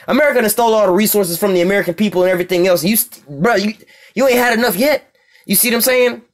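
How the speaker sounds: ordinary talk, nothing marked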